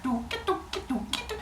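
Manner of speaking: tiny high, soft trotting sounds; little high singsong footstep noises; turtle plodding noises. Acted. Imitates turtle plodding along.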